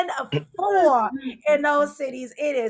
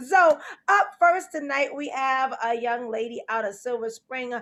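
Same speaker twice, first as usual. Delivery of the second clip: emcee introduction; 5 semitones lower than usual. Acted. Hosting comedian introduction.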